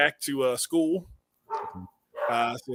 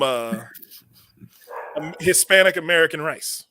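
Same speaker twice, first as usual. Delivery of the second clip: normal talking voice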